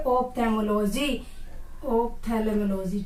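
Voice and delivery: Ophthalmology said very slowly, each syllable drawn out; teacher's slow model word. Shown as a demonstration. Teacher drills students, saying Ophthalmology slowly syllable by syllable. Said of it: "क्या बोलोगे?".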